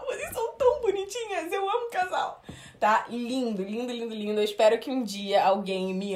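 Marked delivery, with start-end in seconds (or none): 0.0-2.4 put-on drunk gushing voice. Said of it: "eu ia falar".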